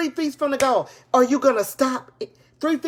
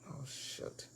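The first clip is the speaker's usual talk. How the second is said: whispered dismay; 10 semitones lower, 24 dB quieter than usual. Real, not acted. Device stopped mid-stream.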